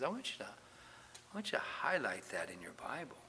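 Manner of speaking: voiceless whisper to the room; whispered aside. Real, not acted. Preacher whispers to his congregation to highlight the verse. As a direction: lean in and whisper the instruction.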